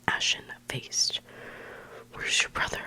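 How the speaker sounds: ordinary delivery, nothing marked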